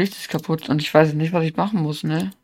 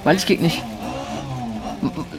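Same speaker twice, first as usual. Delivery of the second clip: scared horror game streamer; 2 semitones lower than usual. Real, not acted. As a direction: stutter in rising distress. Headlight failure panic.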